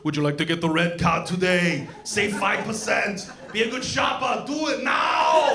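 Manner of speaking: Terminator cashier. Retold or imitated. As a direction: push the red card like a machine. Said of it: "imagining him at the cashier's station".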